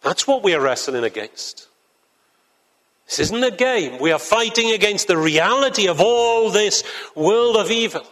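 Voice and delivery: rising, fervent pulpit delivery; preaching at full force. Acted. Preacher rousing the congregation about the spiritual battle, fervent. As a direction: build it like a call to battle.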